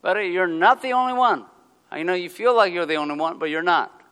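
God teasing a sulking prophet; playful rebuke. Pretend. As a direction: set him straight with a grin. God answering Elijah playfully.

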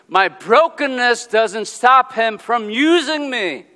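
loud, high, fervent preaching. Acted. Pastor preaching to the congregation, loud and fervent. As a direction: preach it out with full force.

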